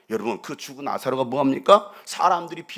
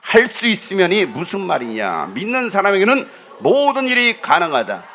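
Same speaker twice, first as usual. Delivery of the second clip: congregation reading aloud; 3 semitones higher, 6 dB louder than usual. Real, not acted. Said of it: "다 같이 시작".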